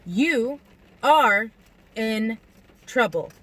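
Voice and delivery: "You; slow, emphasizing each word. A narrator's reading. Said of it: "I said slowly, emphasizing each word".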